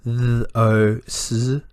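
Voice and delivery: th-o-s-z as separate, held sounds; sounded out. Shown as a demonstration. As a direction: stretch each sound in th-o-s-z. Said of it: "or the sounds".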